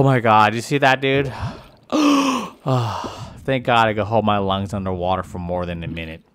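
ordinary talk

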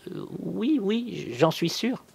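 high, hesitant woman's voice. A narrator's reading. Cousin searching her memory, answers hesitantly in a high voice. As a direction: feel for the answer, pitched well up. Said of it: "Elle hésita quelques secondes".

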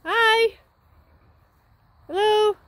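loud high call to a distant horse; calling hello. Real, not acted. Owner greeting her horse from far away, loud high call. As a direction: shout a cheerful hello across the field.

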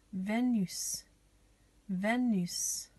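"Venus" said the French way, vay-NÜS; French pronunciation. Shown as a demonstration. Teacher showing children the accent on the E, "Venus" pronounced vay-NÜS. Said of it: "little accent on the E".

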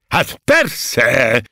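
loud, high, eager shout; enthusiastic cry. A narrator's reading. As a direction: burst out with enthusiasm. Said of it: "kiáltotta lelkesen".

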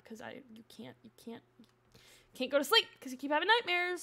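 ordinary talk

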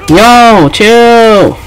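high, stretched plea to back off; heated protest. Real, not acted. Player telling the opponent to ease up, protesting.

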